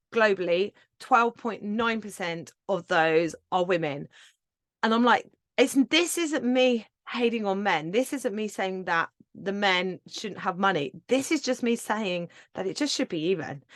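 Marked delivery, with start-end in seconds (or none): none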